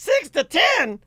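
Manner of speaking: high-pitched, disbelieving echo; mock incredulous echo. Host mocks the guest's dinner idea, mock incredulous. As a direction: throw it back as a high mocking question.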